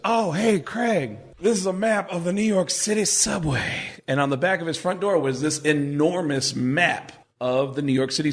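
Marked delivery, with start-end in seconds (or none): 0.1-3.9 lightly voiced, slightly high Mitch; a hint of Mitch. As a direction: casually show the roommate the map. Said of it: "at one point he goes".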